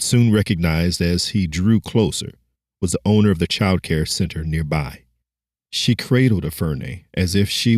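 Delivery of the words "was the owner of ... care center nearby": ordinary delivery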